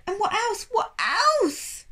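high, loud insistent asking; urgent pleading. A narrator's reading. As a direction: press him hard. Sophie desperate pleading.

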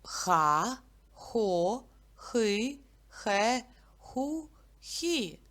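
each syllable Ха, хо, хи clipped apart and punched; teacher drills syllables with the class; syllables read out. Shown as a demonstration. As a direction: read the syllables one by one, crisply. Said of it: "прочитаємо склади".

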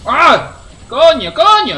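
high, loud startled cursing; alarmed yelp. Real, not acted. Gamer caught by enemies, alarmed yelling. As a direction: yelp and swear loudly.

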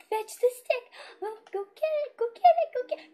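excited calling. Real, not acted. Calling to the dog.

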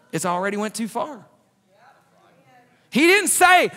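loud, high-pitched preacher's drive; preaching at full force. Acted. Preacher urging the church to forgive, loud and driving. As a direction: preach it hard to the congregation.

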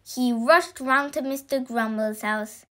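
usual speaking voice

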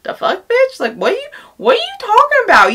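high-pitched, incredulous put-on voice; exaggerated "what are you on about"; mock disbelief. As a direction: act out a judging, baffled look in a high voice. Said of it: "gave me a look like".

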